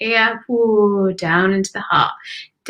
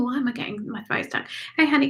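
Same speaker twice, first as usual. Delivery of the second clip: ordinary talk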